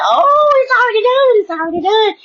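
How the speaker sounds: elated high-pitched celebration; jubilant. Retold or imitated. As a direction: sing it out in pure delight. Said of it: "being so jovial".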